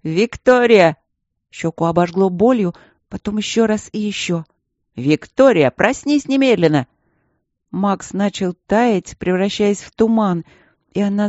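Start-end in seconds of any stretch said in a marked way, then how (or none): 0.0-1.0 loud, high call of «Виктория»; shouting. A narrator's reading. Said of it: "кричал кто-то над ухом". 5.0-6.9 shouting. A narrator's reading. Yelling to wake her.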